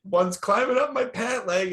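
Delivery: high-pitched hammed-up alarm; mock panic. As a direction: squeal like the mouse is on your leg. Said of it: "He goes".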